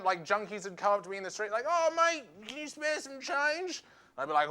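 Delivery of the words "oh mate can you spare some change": junkie begging. Retold or imitated. Junkie voiced asking for change.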